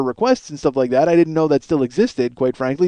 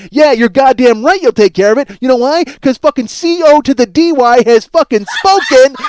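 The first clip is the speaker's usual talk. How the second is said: loud gloating triumph; 6 semitones higher, 5 dB louder than usual. Retold or imitated. Caller gloating to the beaten DJ, hammed-up loud. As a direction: crow your victory at him.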